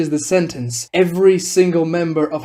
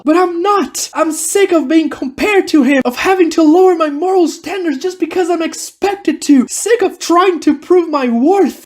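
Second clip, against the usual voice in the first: high-pitched, fed-up protest; upset outburst; 11 semitones higher, 3 dB louder than usual. Acted. Kurotsuchi rebels against the captain.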